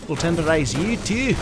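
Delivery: put-on playful Scottish accent. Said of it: "It's my Scottish accent".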